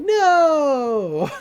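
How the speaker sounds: long, high, loud "no"; hammed-up cry of despair, drawn out; acted wail. [name] loses the room, mock wailing.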